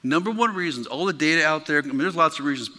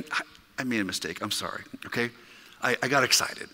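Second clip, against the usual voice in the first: much softer and lower, sheepish; sheepish apology; 6 semitones lower, 8 dB quieter, faster than usual. Real, not acted. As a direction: own up to the mistake quietly. Owning up to his mistake.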